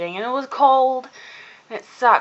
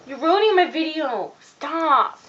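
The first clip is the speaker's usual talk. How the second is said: high, whiny complaint; raised-pitch exasperated protest; playful scolding; 8 semitones higher than usual. Real, not acted. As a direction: plead with someone to quit interrupting. Scolding whoever disrupts her filming.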